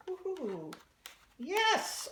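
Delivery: real triumph as the experiment works; delighted cheer. Real, not acted. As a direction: celebrate with a high-pitched yes. Celebrates stapler making wing move.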